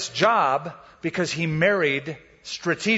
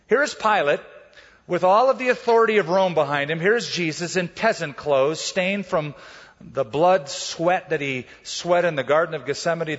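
usual speaking voice

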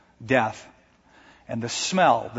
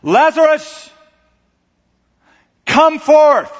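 full-voiced commanding cry, much higher and louder; a booming command to a tomb; mighty shout; 13 semitones higher, 9 dB louder, slower than usual. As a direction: call the dead out with all your voice. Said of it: "It was a shout".

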